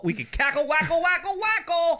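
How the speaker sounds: high silly cackle; cackling. Pretend. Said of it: "we could just giggle and just cackle".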